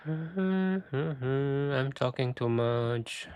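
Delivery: slow, strained tired voice; losing voice. Real, not acted. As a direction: voice giving out from talking. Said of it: "I'm losing my voice".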